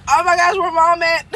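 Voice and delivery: high, fast, laughing panic; nervous panic. Retold or imitated. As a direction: blurt it out in a giggly scared rush. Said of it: "I'm nervous. I'm shaking".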